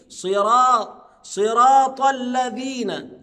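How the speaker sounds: صراط with a heavy emphatic S and slow drawn vowels; emphatic sad in sirat. Shown as a demonstration. Quran teacher drilling صراط الذين, heavy emphatic sad. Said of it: "يبقى صاد".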